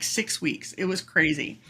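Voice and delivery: normal talking voice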